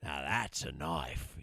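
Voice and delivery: lightly voiced slow, low Dundee; Crocodile Dundee line. Husband quotes Crocodile Dundee, slow and low, a hint of Australian. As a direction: drawl it out low and slow like a tough Aussie. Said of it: "Crocodile Dundee".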